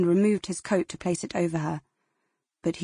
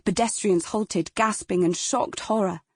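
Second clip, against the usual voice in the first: plain reading voice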